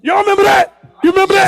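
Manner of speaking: high, loud call to the audience. Real, not acted. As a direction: shout the question out to everyone. Calling out to the room.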